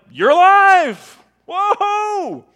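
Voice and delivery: high, loud, drawn-out whoop; excited mock exclamation. Imagined Thomas seeing Jesus alive, hammed-up excited shout.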